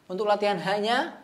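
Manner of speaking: ordinary talk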